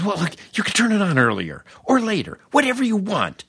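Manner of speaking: high, fast exasperated reply; exasperated engineer. Retold or imitated. The fed-up engineer to the rookie, exasperated. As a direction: throw up your hands in the voice.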